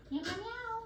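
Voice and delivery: high mock meows; bird meowing. Parrot asked about the cats, answering with high meows. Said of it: "He says that too".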